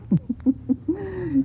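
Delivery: amused laugh. Acted. Laughs at Story's reply.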